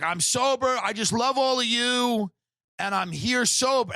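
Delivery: high, slow proud sobriety claim; drawn-out, beaming I'm sober; hammed-up recovering drinker; mock sober guy. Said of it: "He's like".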